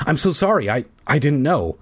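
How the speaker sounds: usual speaking voice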